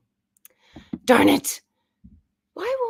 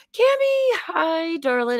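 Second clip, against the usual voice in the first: bright greeting; 10 semitones higher, slower than usual. Real, not acted. Greets viewer warmly, high.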